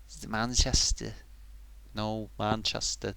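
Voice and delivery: slow, quiet go at Mancunian; attempted Manchester accent. Shown as a demonstration. Testing the accent on the city's name, hesitant Manchester accent. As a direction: feel out a Manchester accent slowly. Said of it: "I can't do Manchester now".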